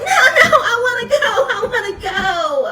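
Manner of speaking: high pleading whine; imitating a crying child. Mimicking the little girl begging mom to let her go, whining. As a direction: beg like a toddler near tears. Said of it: "The baby's like".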